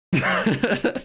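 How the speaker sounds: high burst of real giggling; helpless laugh. Real, not acted. Real laughing fit at crude joke.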